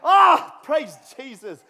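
loud laughing cry; loud delighted laugh, praise Jesus; laughing exclamation. Real, not acted.

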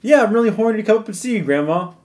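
high, quick phone-call chirp; eager grandchild on the phone. Acted. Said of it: "you wouldn't say".

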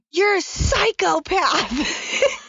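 high, drawn-out mock scream, no real volume; play-scream the accusation at your husband, stretched out; hammed-up high-pitched screech, held back in loudness; retold mock scream. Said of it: "screaming, "You're a psychopath".

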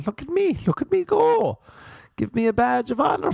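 very high, preening show-off voice; high mock-proud squeal; mock self-congratulation. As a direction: squeal it high, begging to be admired. Mocks own self-congratulation.

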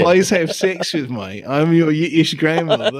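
aim for Yiddish grandmother, land in Cockney; Yiddish slipping into Cockney. Acted. Co-host as Yiddish grandmother, accent badly slipping into Cockney. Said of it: "now it's Cockney".

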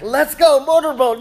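loud, high-pitched mock cheer; hearty urging. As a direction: egg the kid on like an overeager dad. Dad eagerly pushing crying daughter.

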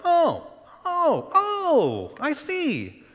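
airy, high mock oh of mild interest; casual oh I see. Shown as a demonstration. Preacher mocking a lukewarm reaction with a high casual oh. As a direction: brush it off like a shrug. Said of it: "It's not like".

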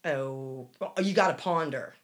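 ordinary talk

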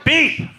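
high, loud beep; shrill alarm beep. Acted. Alarm peaks.

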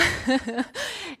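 brief high laugh at herself; short laugh. Real, not acted. Telling her congregation the story, a short laugh. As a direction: laugh it off lightly.

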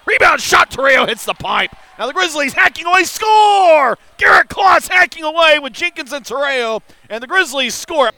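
high, loud, fast goal call; goal call shout. Real, not acted. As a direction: yell as the goal goes in. Goal call in hockey broadcast.

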